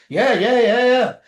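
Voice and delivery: mock dismissive. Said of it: "you can tell she doesn't give a crap".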